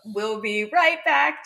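slow, loud cartoon announcer. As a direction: drag it out like a TV break bumper. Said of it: "Do you remember that from Saturday morning cartoons?".

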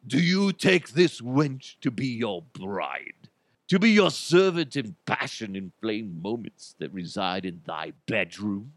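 grand, ceremonial olde-English officiant; Renaissance festival minister. Acted. Minister marrying couple at Renaissance festival, pompous declaiming. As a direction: declaim the vows like a faire minister. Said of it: "I imagine the minister would be".

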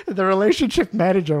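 ordinary delivery

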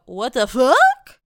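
a stunned, loud WTF; loud exclamation. Retold or imitated. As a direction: blurt it out loud and slow. Exasperated vlogger exclaiming.

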